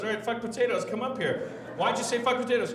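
high, mock-indignant host; challenging host. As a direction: imitate yourself calling out a heckler. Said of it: "And we go".

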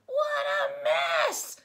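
very high, loud, slow shout; cried out. A narrator's reading. Mother Lion seeing the painted stairs, shouting loud and high. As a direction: shout it, shocked. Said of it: "cried Mother Lion".

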